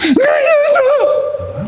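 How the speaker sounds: squealed, stretched-out mock sobbing plea; hammed-up tearful child; crying toddler. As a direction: imitate a whimpering little girl, extremely high and slow. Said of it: "Ella se me queda viendo".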